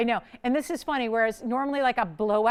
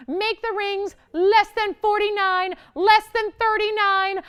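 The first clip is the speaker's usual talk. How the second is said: higher and louder, slow and drawn out; slow hyped countdown; 9 semitones higher, 5 dB louder, slower than usual. Real, not acted. Host cutting the ring price for viewers, slow and hyped. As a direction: stretch each price drop out.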